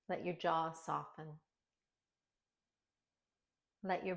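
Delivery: soft, unhurried meditation voice with long pauses; hushed, settling lines that fall away at the ends; guided meditation. Acted. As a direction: guide them gently inward, phrase by phrase, softly.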